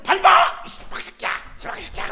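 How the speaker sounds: a loud cry of "stomp" that drops to rasped, voiceless curses; reenacted furious yell. Pastor reenacting a furious wife stomping her husband doll, shout into raspy curse. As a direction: act out the rage at full tilt, then spit the curses. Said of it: "하면서 내가 재현 배우야".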